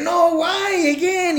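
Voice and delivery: put-on high, drawn-out kid complaint; imitating a whiny objection to homework; frustrated kid. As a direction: protest slowly like a put-upon child. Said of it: "frustrated, angry".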